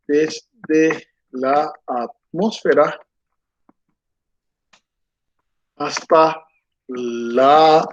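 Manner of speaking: slow, drawn-out words while writing on the board; dictating while writing. Shown as a demonstration. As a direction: spell it out slowly as you write it down. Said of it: "Lo vamos a anotar aquí". (dictado lento mientras escribe en la pizarra)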